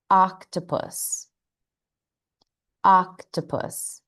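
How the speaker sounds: "Octopus" opened wide on the first vowel, carefully slow; O sound. Shown as a demonstration. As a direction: say "Octopus" slowly with an open ah at the start. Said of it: "now for the O sound".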